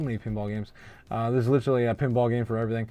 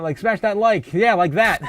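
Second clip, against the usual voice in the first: hyped call to like; 5 semitones higher, 6 dB louder than usual. Real, not acted. Urging viewers to hit like.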